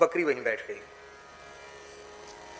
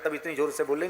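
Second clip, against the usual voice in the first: ordinary talk, nothing marked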